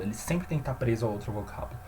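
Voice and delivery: ordinary delivery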